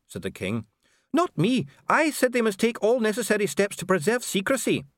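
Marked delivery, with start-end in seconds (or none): 1.1-4.9 higher, pleading dwarf voice; a cornered dwarf pleading his case; anxious dwarf Dee. Acted. Dee defends himself before the king, high and pleading.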